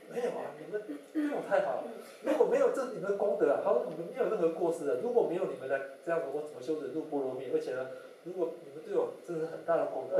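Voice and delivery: high, emphatic protest in a retold voice; 急切推辞. 喇嘛急忙劝慰来忏悔的人. (激动地连声推辞)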